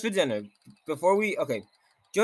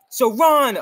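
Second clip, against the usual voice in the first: urgent shout; 6 semitones higher, 7 dB louder than usual. Acted. Urging flight.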